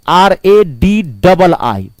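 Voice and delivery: slow, each letter of आर ए डी डबल आई separate; spelled out slowly. Shown as a demonstration. Teacher spelling radii slowly for the class. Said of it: "radii बोलते हैं".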